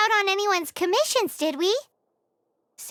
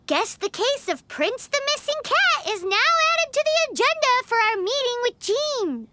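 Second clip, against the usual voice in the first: usual speaking voice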